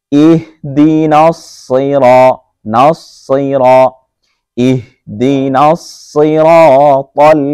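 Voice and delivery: slow, melodic tajweed recitation; Quran recitation. Shown as a demonstration. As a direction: recite slowly with drawn vowels. Recites verse.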